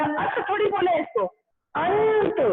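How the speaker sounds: अंत said high, nasal then त; अंत, clear आ plus nasal plus त; teaching demo. Shown as a demonstration. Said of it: "तो हमने क्या बोला". (अंत का स्पष्ट उच्चारण)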